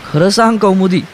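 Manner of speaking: high, urgent shouted demand; shouting. A narrator's reading. Man to the injured Kaumudi, shouting. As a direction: shout it, pleading for the truth. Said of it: "तो ओरडला".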